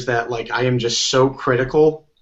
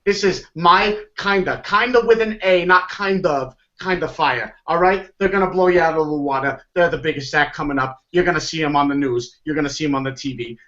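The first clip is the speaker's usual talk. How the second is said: a hint of a Brooklyn booker's pitch; lightly put-on Brooklyn hustle; Brooklyn accent; 4 semitones higher than usual. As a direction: sell the band like a fast-talking Brooklyn manager. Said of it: "I have this weird Brooklyn accent".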